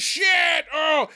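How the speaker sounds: high startled yelp; mock shock. Troll jumped by a surprise troll, high mock shock. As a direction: yelp it like a hammed-up scare.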